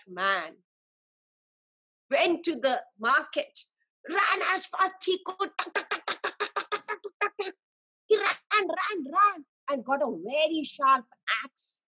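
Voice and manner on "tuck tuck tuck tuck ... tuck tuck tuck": running footsteps sound. Pretend.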